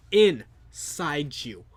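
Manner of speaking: hammed-up sinister repeat; mock menace. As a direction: stretch the words like a villain. Repeated menacing threat, drawn out.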